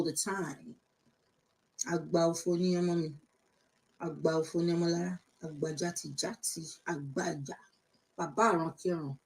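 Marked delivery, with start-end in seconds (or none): none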